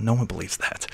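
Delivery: quiet aside to yourself, muffled; muffled under the breath. Shown as a demonstration. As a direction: mutter it into your hand, barely audible. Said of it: "sort of muffled".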